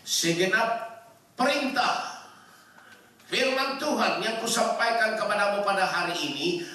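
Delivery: high, ringing declamation easing down; emphatic scripture preaching. Real, not acted. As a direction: proclaim the verse phrase by phrase. Declaims the verse at full force.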